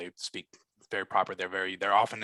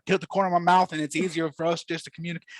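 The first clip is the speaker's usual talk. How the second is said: higher-pitched, lively Southern twang; Southern accent; 7 semitones higher, faster than usual. Shown as a demonstration. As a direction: Georgia Southern twang, talking out of the corner of the mouth. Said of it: "and they sound like this".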